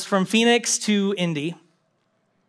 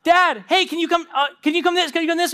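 put-on much higher, insistent kid voice; child calling dad; 9 semitones higher than usual. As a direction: pester dad like a little kid. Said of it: "You know, or, or".

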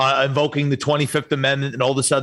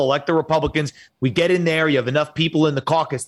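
ordinary delivery

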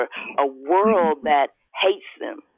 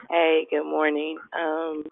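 normal talking voice

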